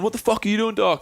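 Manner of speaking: high-pitched retold mate's ribbing; mock abuse. Childhood mate sits down beside him, mock abusive. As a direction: imitate a mate laying into him, pitched right up. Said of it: "Starts abusing me".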